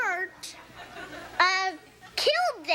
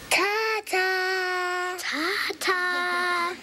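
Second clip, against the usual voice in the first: put-on child's goodbye; 4 semitones higher, much slower than usual. Child demonstrates a word sound.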